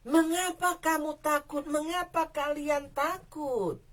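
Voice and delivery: mildly voiced Jesus asking earnestly; searching question. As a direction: ask the frightened disciples why they fear. Jesus' words retaken.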